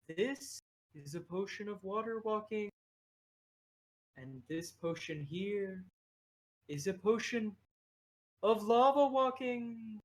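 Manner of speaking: odd little creature making a pronouncement; little creature oracle. Acted. Game master plays Abel.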